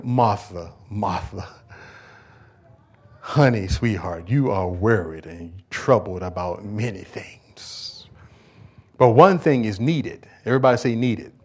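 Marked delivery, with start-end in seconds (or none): 0.0-10.2 low, slow, tender comfort; loving Jesus. Acted. Jesus gently correcting anxious Martha, tender and low. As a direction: soothe her gently like a loving friend. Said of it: "the love in his eyes".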